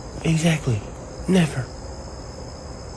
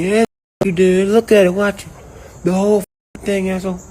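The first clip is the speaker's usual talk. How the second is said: louder, higher, pushy Trump impression; hammed-up insistence, shoving the video at him; imitating Trump; 6 semitones higher, 4 dB louder than usual. Trump telling the African leader to watch the video, pushy and loud. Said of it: "Trump's like".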